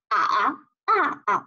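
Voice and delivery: 啊 dipping and rising, then falling; slow 啊 through third and fourth tones; tone sequence. Shown as a demonstration. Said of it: "合在一起呢".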